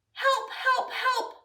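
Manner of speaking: high mock cry for help; lightly hammed-up high help call. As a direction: call out like a little truck in trouble. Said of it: "cried the little blue truck".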